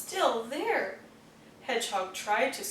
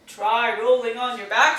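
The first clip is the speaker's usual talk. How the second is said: snorting pig; 3 semitones lower, 8 dB louder than usual. Acted. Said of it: "snorted Pig".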